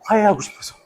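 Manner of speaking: ordinary talk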